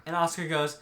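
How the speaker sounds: usual speaking voice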